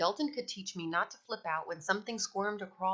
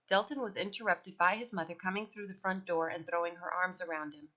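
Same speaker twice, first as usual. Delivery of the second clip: plain reading voice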